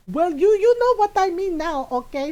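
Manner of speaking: put-on high, loud, defensive Filipino-accented mom; mom's Filipino accent. Mom defending herself, in a loud Filipino accent. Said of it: "in my mom's voice".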